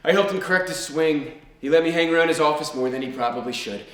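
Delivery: usual speaking voice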